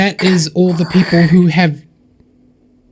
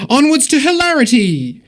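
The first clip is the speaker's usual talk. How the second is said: much higher, hammed-up rallying cry; mock heroic battle cry; 8 semitones higher than usual. Host reworking a donor's message as a mock heroic rally cry. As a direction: proclaim it like a mock quest leader. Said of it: "This is D and D".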